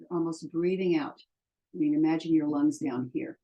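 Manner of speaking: usual speaking voice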